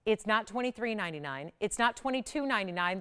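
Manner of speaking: ordinary talk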